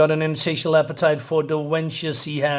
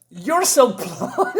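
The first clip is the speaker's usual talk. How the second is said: much higher, slow, laughing through the words; giggly girl voice; 8 semitones higher, much slower than usual. Retold or imitated. Reteller voicing Rula greeting Fifa, high and giggling. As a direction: let the giggle break the line. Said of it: "And she says".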